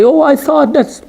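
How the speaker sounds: high, airy guess tossed off lightly; a teacher lightly mocking careless hearsay; mock careless student. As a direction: play the student who shrugs off a half-remembered ruling. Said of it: "And say".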